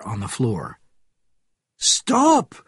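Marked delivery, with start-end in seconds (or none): 1.8-2.6 high, loud scream; screamed command. A narrator's reading. Frenchman to the nurse burning her victim, screamed. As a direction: scream it to halt a killer. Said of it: "screamed the man known as".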